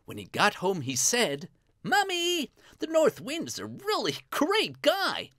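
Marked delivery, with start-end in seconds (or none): 1.8-5.3 put-on high, eager boy voice; Lad, excited. Lad tells his mother of the ram, high and excited. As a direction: burst out with good news to mother.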